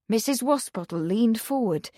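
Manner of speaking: ordinary delivery, nothing marked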